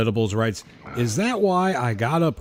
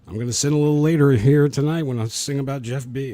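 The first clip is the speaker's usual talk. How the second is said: ordinary delivery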